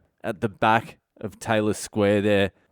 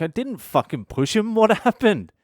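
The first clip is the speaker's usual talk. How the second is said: alarmed protest; 10 semitones higher than usual. Retold or imitated. Protesting innocence, retold.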